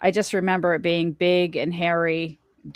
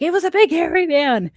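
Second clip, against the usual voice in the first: put-on much higher, louder frightened child insisting; insistent scared child; 11 semitones higher, 5 dB louder than usual. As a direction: voice the little girl insisting it was a man. Daughter insists to mother.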